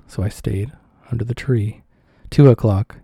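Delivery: usual speaking voice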